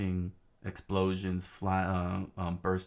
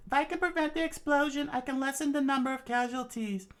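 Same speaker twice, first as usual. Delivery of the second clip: very high falsetto, starting quick; determined princess. Acted. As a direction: reason urgently in falsetto. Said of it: "she said".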